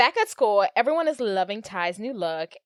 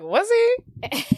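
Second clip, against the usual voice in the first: much higher, eager little question; high giddy "was he"; high hopeful squeak; 12 semitones higher than usual. Retold or imitated. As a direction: squeal it like a girl with a crush. [name]'s hopeful reply voiced.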